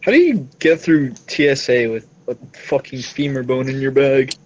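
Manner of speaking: ordinary delivery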